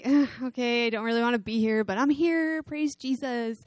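reluctant attitude. Retold or imitated. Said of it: "like, attitude".

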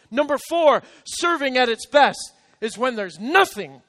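loud, high preaching cadence; preaching at full force. Real, not acted. Pastor preaching to the congregation, loud and high. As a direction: drive each point home to the crowd.

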